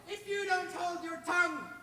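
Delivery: high, loud bluffing shout; shouting boy. Acted. Boy bluffing the troll with a high, loud shout. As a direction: shout the bluff right at him. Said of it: "he shouted at the troll".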